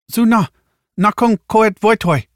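high, loud alien speech; alien language. Acted. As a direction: pitch up and call it out in an alien tongue. Alien woman speaks own tongue.

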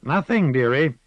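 lightly voiced old gossip; a hint of a sly old woman; old village witch. Witch Moss speaks.

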